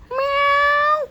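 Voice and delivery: very high, loud imitated meow; cat meow. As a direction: squeal a long cat meow. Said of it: "that cat noise".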